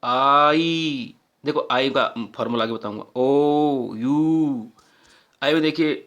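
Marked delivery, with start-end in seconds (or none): 0.0-1.1 आई gliding from आ into ई; vowel आई demo. Shown as a demonstration. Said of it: "अब बोल के देखो". 3.2-4.7 ओ and यू each held as one clear vowel; vowel demo. Shown as a demonstration. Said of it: "अब बोल के देखो".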